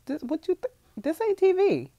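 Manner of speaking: stern mom. Retold or imitated. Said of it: "I have said stuff like".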